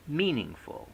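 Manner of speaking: "meaningful" stressed on MEAN; first-syllable stress. Shown as a demonstration. Lecturer showing the normal stress to students, MEAN-ing-ful. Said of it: "primary stress on the first syllable".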